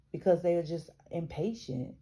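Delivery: ordinary delivery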